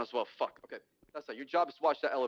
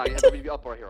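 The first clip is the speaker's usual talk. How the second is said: louder rallying call to the squad; loud command; 2 semitones lower, 10 dB louder than usual. Real, not acted. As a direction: bark the order to the whole team. Rallying team before push.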